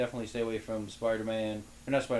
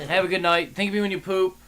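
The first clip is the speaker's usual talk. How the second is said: loud; 8 semitones higher, 9 dB louder than usual. Real, not acted. Loud joking sign-off.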